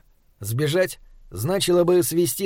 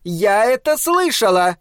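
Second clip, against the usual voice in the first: indignant; 6 semitones higher, 3 dB louder than usual. Acted. Wife overhears.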